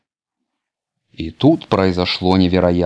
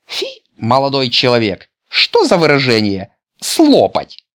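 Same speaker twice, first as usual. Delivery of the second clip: prim granny; 6 semitones higher, 4 dB louder than usual. A narrator's reading. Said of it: "сказала бабушка".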